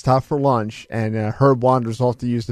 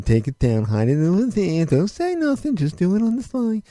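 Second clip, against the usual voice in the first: Herb. Retold or imitated. Coworker mimics the boss ordering a sign hidden, boss impression. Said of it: "we would just talk like Herb all day long".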